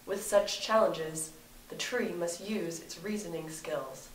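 hushed wildlife documentary narrator; nature documentary. Acted.

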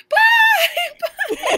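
very high, loud yell with laughter; screamed goodbye. Retold or imitated. Fans still saying bye while leaving venue, screamed goodbye. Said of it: "still screaming at us, like, saying bye".

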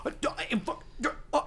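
unvoiced, sputtering mock freak-out; super animated fan. Imitating a fan losing it to a voice actor, sputtering excitement. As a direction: stammer in broken, overexcited bursts. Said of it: "super animated".

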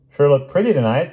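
high, loud small-town compliment; admiring druggist. Acted. Druggist compliments his customer, high admiring voice. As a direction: lift it brightly as a flattering shopkeeper.

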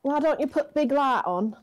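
put-on Manchester accent. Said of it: "That's what she says".

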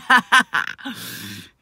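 loud, high burst of laughter. Real, not acted. Laughs after "Yes, I am.

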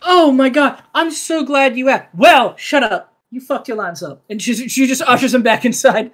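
ordinary talk

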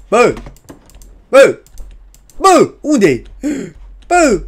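high, loud alarmed shout; calling out. Real, not acted. Player searching for the baby, high loud call. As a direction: yell for someone who has vanished.

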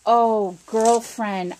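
usual speaking voice

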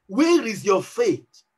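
slow, low, measured question; calm reproof. Acted. Jesus answering a panicking believer, slow and calmly reproving.

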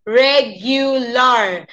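very slow Regular, reg-you-lar; English U in Regular. Shown as a demonstration. Teacher demonstrating the U, Regular as reg-you-lar. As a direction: break Regular into syllables with a "you". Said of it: "en inglés la U".

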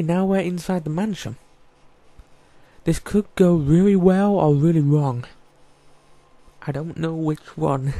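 ordinary talk

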